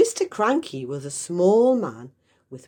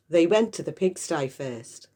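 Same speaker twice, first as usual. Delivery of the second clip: ordinary delivery, nothing marked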